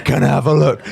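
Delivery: high, loud eager question; excited trainee. Retold or imitated. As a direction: beg to see it like a kid. Trainees gushing over bus.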